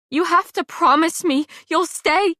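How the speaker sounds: high, loud tearful begging; desperate plea. Acted. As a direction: beg through tears. Begging dying friend.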